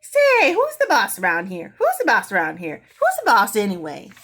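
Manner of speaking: a high, loud shriek on say, then loud demanding squawks; bossy bird shouting across the room; parrot yelling. A narrator's reading. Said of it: "Penelope yelled out".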